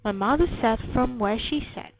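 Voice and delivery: usual speaking voice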